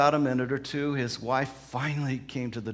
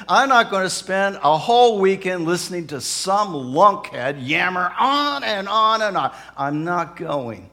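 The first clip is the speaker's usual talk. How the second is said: loud griping husband; acted angry tirade, slowing to a firm finish; indignant refusal; 4 semitones higher, 10 dB louder than usual. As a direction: complain loudly, dragging out the on and on.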